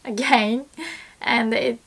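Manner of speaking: ordinary talk, nothing marked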